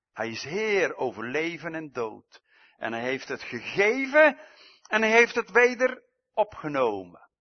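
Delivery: preacher proclaiming Christ's power over death, rising; preaching climax. Acted. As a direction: proclaim it to the back row.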